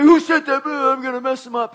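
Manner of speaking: high, put-on tough guy voice; angry threat. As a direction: imitate a guy spoiling for a fight. Charging guy threatens the group.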